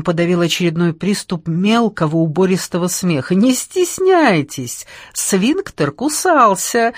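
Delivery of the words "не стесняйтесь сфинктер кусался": slow, high chanting recital; mock-poetic chant, raised pitch; singsong declamation. A narrator's reading. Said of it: "продекламировал он нараспев".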